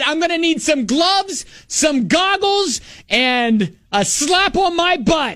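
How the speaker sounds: playful high request; mock bravado. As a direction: ham up the eager customer. Her own joking request retold.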